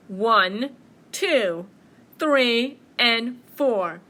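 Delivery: loud, deliberate bear count. Acted. Bear counting out apples, booming. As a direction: boom each number separately.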